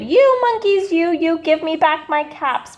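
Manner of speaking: stamp both feet and shout at the monkeys; angry shout. A narrator's reading. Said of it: "stamped both of his feet and shouted".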